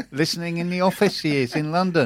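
high, laughing bad Scottish accent. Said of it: "Your accent's terrible".

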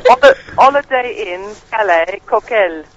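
put-on slow, hammy French accent; bad French accent, a bit Indian; mock French accent. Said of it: "Sounds a bit Indian".

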